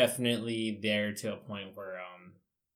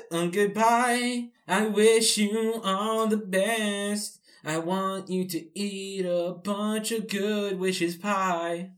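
silly goodbye voice; 9 semitones higher, much slower than usual. Pretend. Silly podcast sign-off.

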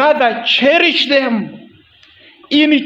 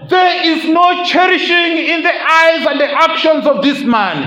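raised, higher accusing voice of the carpets; carpet's loud accusation; 7 semitones higher than usual. Pretend. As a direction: let the carpets cry out against him. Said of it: "the carpets would say".

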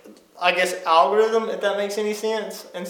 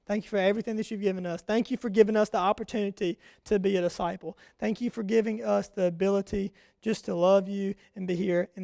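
soft, quick spoken prayer; 8 dB quieter than usual. Real, not acted. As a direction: pray softly to God.

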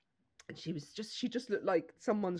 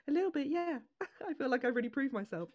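smug little gran; 7 semitones higher than usual. Retold or imitated. Gran's smug reply voiced.

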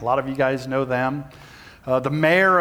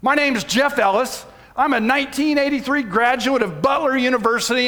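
high, raised announcer-like call easing off toward the end; top-of-lungs yell; 6 semitones higher than usual. Retold or imitated. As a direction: call a whole room to attention. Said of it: "I yelled out, top of my lungs".

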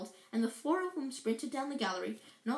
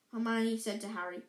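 plain reading voice

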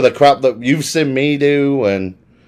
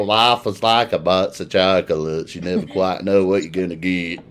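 put-on American accent Forrest Gump; 9 semitones lower, 6 dB quieter than usual. Englishman showing off his Forrest Gump American accent. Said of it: "I can do my American accent though".

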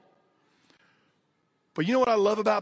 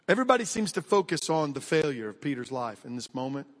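ordinary talk, nothing marked

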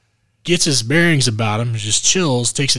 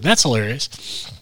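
normal talking voice

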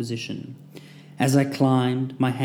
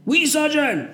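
barked reply; slower than usual. A narrator's reading. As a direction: answer like recruits. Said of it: "we all barked in unison".

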